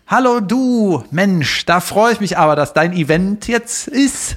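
high, gushing video-greeting voice; overly sweet canned well-wishes; cheesy celebrity greeting. Pretend. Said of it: "ich mach dir mal ein Beispiel".

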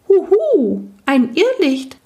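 high, loud owl-like hoot; hooting night goblin. Acted. Night goblin spots the will-o'-wisp, high hooting voice. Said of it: "raunte der Nachtalb".

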